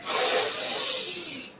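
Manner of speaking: sharp breathy sniff in, pitched high; imitated massive inhale. Retelling the ceremony to friends, imitating a massive inhale. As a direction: suck in a huge dragon-like breath through the nose. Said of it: "I took this massive inhale".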